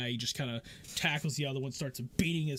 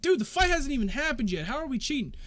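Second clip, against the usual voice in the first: defensive; 12 semitones higher, 7 dB louder than usual. Acted. Accused fighter argues back.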